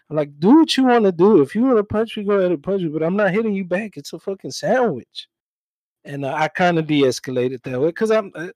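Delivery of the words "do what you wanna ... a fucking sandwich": louder and higher, daring him to swing; fed-up outburst. Retold or imitated. To a coworker threatening a punch over a sandwich, a loud exasperated dare. Retold standoff with angry coworker.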